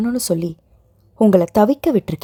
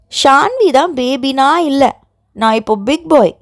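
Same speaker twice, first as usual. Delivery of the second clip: put-on scolding child shout; 4 semitones higher than usual. A narrator's reading. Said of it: "அதட்டலுடன் கத்தினான்".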